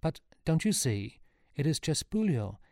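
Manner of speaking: pleading insistence; urgent appeal. A narrator's reading. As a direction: make him understand. Sean urging centurion to understand.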